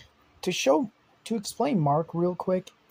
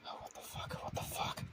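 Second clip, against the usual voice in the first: stunned, barely voiced whisper; shocked whisper; 16 dB quieter than usual. Mark whispering in shock holding his dad.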